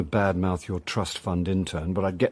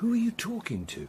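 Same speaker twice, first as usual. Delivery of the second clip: soft, hushed and higher; whispered question; 12 semitones higher, 8 dB quieter than usual. Real, not acted. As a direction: keep it down, she's on the phone. Son whispering, mother on phone.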